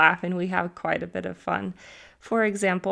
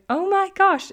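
high, loud "can you believe it" outburst; mock exclamation; 12 semitones higher, 9 dB louder than usual. Teacher reacting to easily amused teenagers, high mock exclamation. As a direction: exclaim it loudly, pitched way up.

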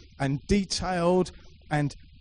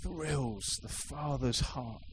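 1 slow hush; 9 dB quieter, much slower than usual. Real, not acted. Slow hushed preaching.

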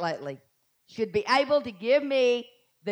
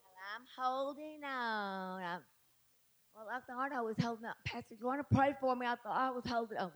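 soft, whiny believer losing grip; a hint of a doubter, much softer; mocking a wavering believer; 11 dB quieter than usual. Preacher imitates a weak believer to the church, soft and whiny. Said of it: "a lot of y'all are doing".

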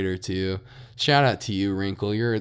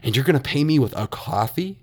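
louder, higher, incredulous mock outrage; retold angry outburst; 5 semitones higher, 3 dB louder than usual. Retelling his reply to the classmate, heated disbelief. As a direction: replay the fed-up retort with rising disbelief. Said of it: "I got so pissed".

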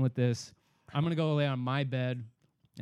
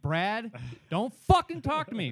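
rising from a warning to a high-pitched yell; acted exasperated outburst; 6 semitones higher than usual. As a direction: shut the friend down, exploding mid-sentence. Retold angry warning to friend.

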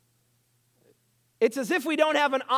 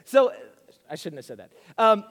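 ordinary delivery

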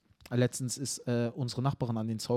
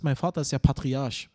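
normal talking voice

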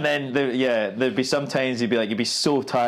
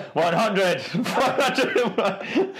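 automated rep counter; 10 semitones higher, much slower than usual. Retold or imitated. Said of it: "an automated voice in the corner".

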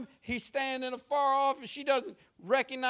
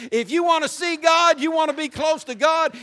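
louder, higher, full-force preaching; preaching shout; 5 semitones higher, 8 dB louder than usual. Real, not acted. Preacher exhorting the congregation to draw near, shouting. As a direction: shout it out to the church.

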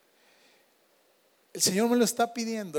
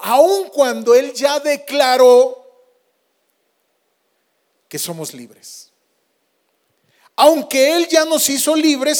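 predicación enérgica; 6 semitones higher, 8 dB louder, slower than usual. Acted. Predica con fuerza.

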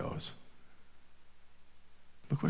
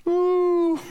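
mock ooh; 7 dB louder than usual. Preacher reacting to dread of the letter.

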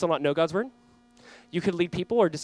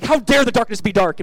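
loud, higher, indignant mock scolding; mock outraged yell; 6 semitones higher, 8 dB louder than usual. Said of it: "how about we stop yelling at darkness".

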